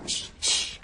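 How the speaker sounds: whispered shush; urgent hushing. Acted. Governess hushes the room as Mister Hatfield approaches, urgent whisper. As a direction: hush everyone before the visitor hears.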